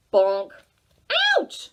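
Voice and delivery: ordinary delivery, nothing marked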